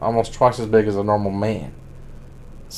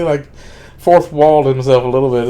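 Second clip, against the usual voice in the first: ordinary talk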